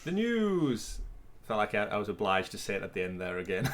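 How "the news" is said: high, drawn-out swoop falling away; segment call. Pretend. Host obliged to finish co-host's intro, drawn-out call. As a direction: stretch the segment title like a jingle.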